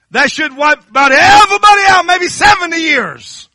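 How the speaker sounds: high, loud, slow shouted preaching; preaching at full force. Real, not acted. Preacher shouting to the congregation about dead things. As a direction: lift it into a shouting sermon climax.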